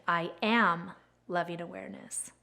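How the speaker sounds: "am" stretched out, then a pause; stress on AM. Retold or imitated. Quoting a favorite mantra for viewers, stress on "am". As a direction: lean on "am", then softly finish the phrase. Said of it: "he puts the emphasis on different words".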